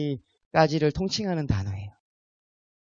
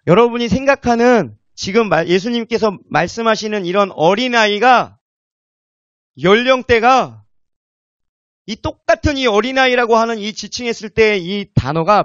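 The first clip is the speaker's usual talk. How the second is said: loud, raised-pitch preacher's delivery; emphatic preaching; 6 semitones higher, 7 dB louder than usual. Acted. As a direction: drive each phrase out to the congregation. Preaches at full force. (힘주어 외치는 설교)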